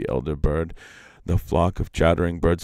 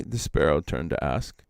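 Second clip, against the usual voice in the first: plain reading voice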